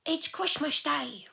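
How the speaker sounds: very high, slow little sprout; tiny sprout voice. Acted. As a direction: squeak it like a small child.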